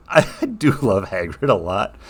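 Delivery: usual speaking voice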